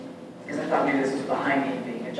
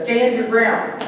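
firm command; 6 semitones higher, 5 dB louder, slower than usual. Retold or imitated. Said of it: "And then it says".

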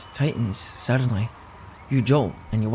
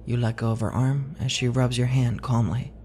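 plain reading voice

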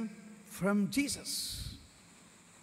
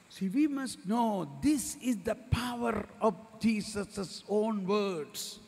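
normal talking voice

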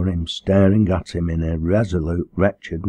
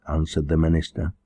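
usual speaking voice

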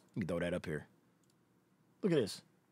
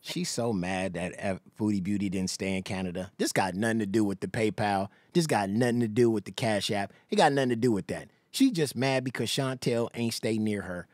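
a heavy, low, sulky mock voice; sneering low impression of her sulking; 11 semitones lower, 3 dB quieter than usual. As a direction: drop the pitch way down, mocking her. Mocking voice about her feelings.

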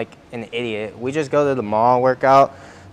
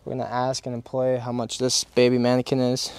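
ordinary delivery

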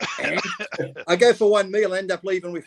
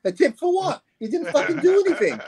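much higher, incredulous complaint; indignant outburst; 10 semitones higher than usual. Retold or imitated. Diner mocking the buffet tip request, outraged and pitched up. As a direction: sound outraged at the nerve.

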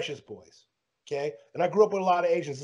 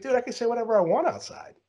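higher, worked-up retort at the manager; retold heated protest; 9 semitones higher than usual. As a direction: argue back, indignant and high.

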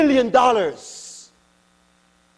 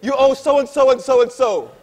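mock bill collector; 6 semitones higher, 6 dB louder than usual. Collection agent on the phone.

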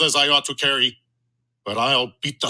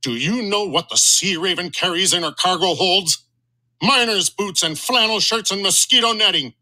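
higher, outraged sea-captain bluster; captain's indignant rant; 6 semitones higher than usual. Acted. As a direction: scoff at the rival's cargo. Captain rails about Sea Raven.

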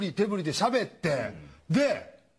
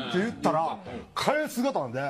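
ordinary talk

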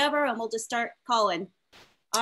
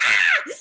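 very high mock toddler shriek, loud; kids shrieking; 7 dB louder than usual. Two-year-olds answering her with a loud high mock shriek. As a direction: imitate the toddlers screaming back at her. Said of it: "And they were like".

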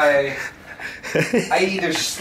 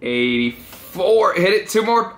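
ordinary talk, nothing marked

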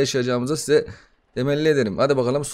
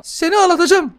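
a tiny brat swearing; squeaky baby voice; 14 semitones higher than usual. Pretend. Gamer voices the demon baby cursing him, squeaky.

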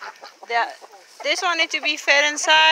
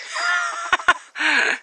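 high laugh; 3 dB quieter than usual. Real, not acted. Laughs at kicking chicken.

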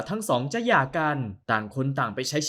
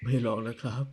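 soft, lower soothing; tender hush; 3 semitones lower, 5 dB quieter than usual. Acted. Husband hushing wife's tears, soft and tender. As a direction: coax her quietly.

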